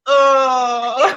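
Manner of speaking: mock pained groan. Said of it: "laying on the floor going".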